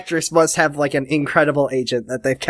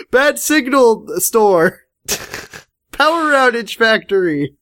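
silly slow voice; 6 semitones higher than usual. Pretend. Joking game store names.

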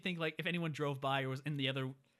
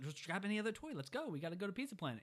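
hurried mom; 6 semitones higher, 3 dB quieter than usual. Retold or imitated. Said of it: "his mom's like".

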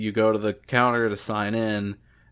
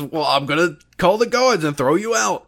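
huffy guard; 7 semitones higher, 5 dB louder than usual. Acted. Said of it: "and he says".

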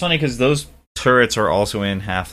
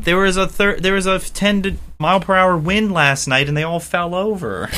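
higher, mock-dismayed official voice; mock dismayed lament; 8 semitones higher than usual. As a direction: whine it like a baffled engineer.

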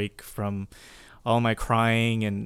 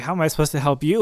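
fast, louder anxious question; frantic self-quote; 5 semitones higher, 6 dB louder, much faster than usual. Retold or imitated. Counselor panicking about helping clients, quick and louder. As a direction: rush it out like a helpless counselor. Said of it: "I'm like".

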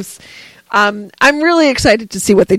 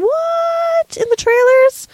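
surprised; 3 dB quieter, slower than usual. Real, not acted. Excited about trailer costume.

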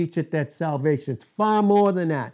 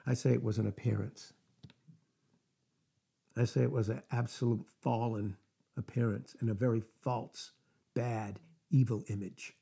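hushed; 6 semitones lower, 11 dB quieter than usual. Real, not acted. Hushed emphatic aside.

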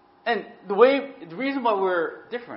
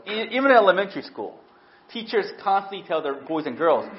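ordinary delivery

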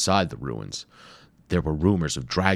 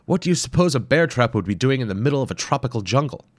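fast, higher, louder ironic question; wry trapped explorer; 7 semitones higher, 5 dB louder, faster than usual. Acted. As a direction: joke quickly from inside the pit. Hayabusa from the trap.

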